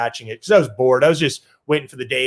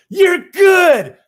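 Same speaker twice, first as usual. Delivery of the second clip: much higher, loud burst of praise; excited shout; 7 dB louder than usual. Retold or imitated. Ted Williams praising the kid, a loud high shout. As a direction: bark it out thrilled like an old legend.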